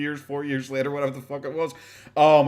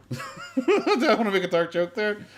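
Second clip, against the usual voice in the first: higher and slow, laughing through the question; a laughing tease about a dark joke; laughing repeat; 8 semitones higher than usual. Real, not acted. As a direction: giggle as you ask it again.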